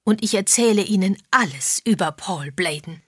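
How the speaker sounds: mildly loud announcement; speaking out loud. A narrator's reading. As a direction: say it out for others to hear. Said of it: "sagte Misses Josephs laut".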